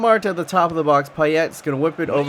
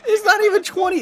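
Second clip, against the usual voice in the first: astonished. Retold or imitated. Streamer baffled by how easy the match is, high astonished cry. Voicing his astonishment at the score.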